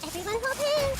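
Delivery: loud, high rallying cry; rallying shout. Acted. Rallying the party mid-battle, loud high shout. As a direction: call the team together over the din. Combat call to allies.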